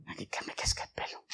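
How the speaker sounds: hushed whisper, played for a joke; comic whispered request; mock secret prayer. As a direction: whisper a pretend plea under your breath. Jokingly mimes a private prayer.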